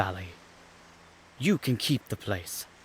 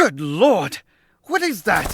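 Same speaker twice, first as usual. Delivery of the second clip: startled; 8 semitones higher, 8 dB louder than usual. Real, not acted. Startled by parrot.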